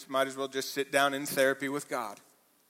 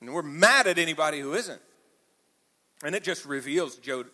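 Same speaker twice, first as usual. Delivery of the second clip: usual speaking voice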